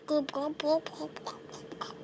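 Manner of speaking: soft, near-silent glub glub noises; tiny hushed fish bubbling. Pretend. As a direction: murmur bubbly fish sounds. Imitating the fish on a pen.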